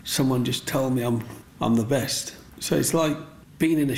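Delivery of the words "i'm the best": normal talking voice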